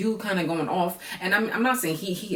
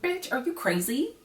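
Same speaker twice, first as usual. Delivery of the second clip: high-pitched disbelief, pitch jumps up; incredulous; 10 semitones higher, 4 dB quieter than usual. Real, not acted. Reacting to a cast member's claim, shocked and incredulous. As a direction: ask it like you can't believe your ears.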